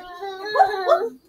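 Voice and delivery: high startled whoop. Real, not acted. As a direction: yelp as you slip. Slipping, calling out.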